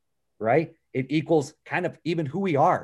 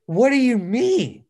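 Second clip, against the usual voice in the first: higher, louder, slow annoyed question; upset dad; 10 semitones higher, 5 dB louder, slower than usual. Retold or imitated. Retelling how he snapped at his daughter, annoyed. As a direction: snap back at a crying child. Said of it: "I was upset with her".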